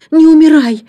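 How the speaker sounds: put-on high, loud child's scream; desperate cry. A narrator's reading. Джейн to the nanny on rushing home, desperate cry. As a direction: cry out as if to stop a death. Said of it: "закричала Джейн".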